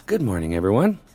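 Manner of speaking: normal talking voice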